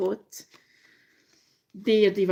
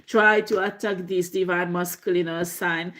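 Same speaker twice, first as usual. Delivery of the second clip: normal talking voice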